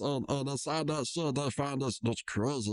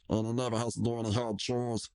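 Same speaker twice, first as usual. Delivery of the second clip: ordinary delivery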